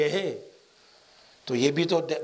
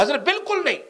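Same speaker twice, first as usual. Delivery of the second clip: raised high, a mock angry retort; a hint of a hot-tempered protest; angry refusal; 9 semitones higher than usual. Young man angrily refusing his elder, hammed-up anger. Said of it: "بہت غصے میں کہا".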